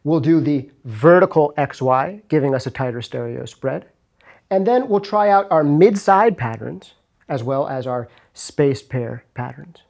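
usual speaking voice

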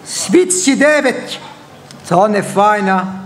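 ordinary talk, nothing marked

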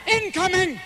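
hammed-up soldier's alert; mock alarm cry. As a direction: yell it like a war-movie warning. Fart warning shout.

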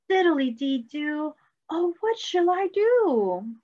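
high, slow sing-song fret; worried sing-song refrain. Acted. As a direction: chant it like a fretting storybook character. Refrain repeats.

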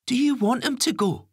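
put-on high young boy, accusing. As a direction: challenge mum like a kid.

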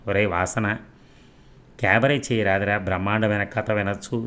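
ordinary delivery, nothing marked

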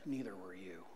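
soft, low and gentle; God answering ever so gently, soft and low. Retold or imitated. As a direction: lay it down quietly like a tender rebuke. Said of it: "ever so gently, said".

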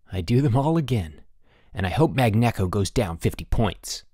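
ordinary delivery

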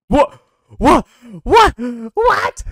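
loud, very high repeated yelps; startled disbelief. Real, not acted. Player startled by what just happened, shrieking in disbelief.

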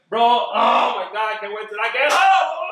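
high, loud burst of eagerness; mock excited shout. Retelling himself in the car eager to argue, mock shouting. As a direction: shout it like you can't wait to get home. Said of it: "I get in the car and I'm like".

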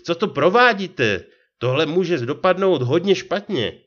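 frightened, high and loud, falling on "provádíte"; alarmed plea. A narrator's reading. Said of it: "zasténal převozník".

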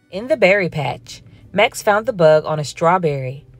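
ordinary delivery

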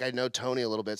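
normal talking voice

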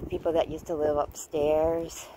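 ordinary delivery

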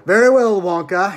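loud, important opening; self-important. Acted. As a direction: announce it as a man of importance. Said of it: "he said importantly".

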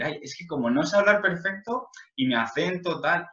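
high, embarrassed mock voice of a learner; mock insecure self. Retelling his own fear of speaking English, high mock whine. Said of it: "Tenía esa vergüenza de decir".

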